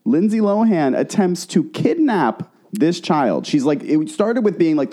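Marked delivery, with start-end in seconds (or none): 0.0-3.5 slow, higher, deliberate announcement; emphatic repeat. Real, not acted. Host repeating the shocking kidnap claim to listeners, slow emphasis. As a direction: hammer out each word slowly for effect. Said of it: "Let me repeat".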